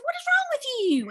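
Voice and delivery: high-pitched mock exasperation; incredulous demand. Confronting a friend acting weird at dinner, incredulous.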